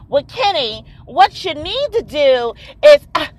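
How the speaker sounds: high, loud nagging mom voice; mom scolding. Retold or imitated. Mom butting in from the background, scolding her daughter, high and loud. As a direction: nag like a mother who saw this coming.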